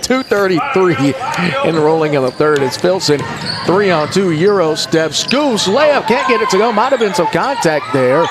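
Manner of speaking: sportscaster calling a layup; live play-by-play. Acted. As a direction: pitch climbing as the shot goes up.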